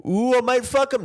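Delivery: high, leering creep voice; creepy leering voice. Retold or imitated. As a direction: leer it out in a high voice. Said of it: "going like".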